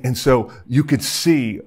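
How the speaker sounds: normal talking voice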